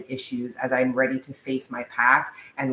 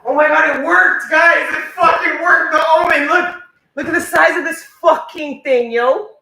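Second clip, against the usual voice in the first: higher and louder, thrilled it worked; triumphant outburst over the trapped bug; excited shout; 11 dB louder than usual. Real, not acted. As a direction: shout with real disbelief and glee.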